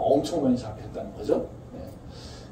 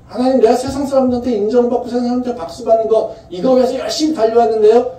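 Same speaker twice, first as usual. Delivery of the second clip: high, loud, slowed cry to God; preacher's fervent high cry; impassioned plea; 10 semitones higher, 7 dB louder than usual. Acted. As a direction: pour the confession out, rising. Impassioned voiced confession to God.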